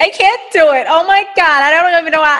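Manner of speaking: high, loud helpless whine; hammed-up damsel in distress, rising to a squeal; dramatic damsel. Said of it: "I'm dramatic as shit".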